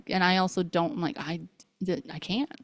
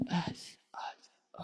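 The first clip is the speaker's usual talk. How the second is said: barely voiced, hesitant stammer; stunned whisper; 15 dB quieter than usual. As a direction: act out being lost for words, under the breath. Her stunned reaction, acted out.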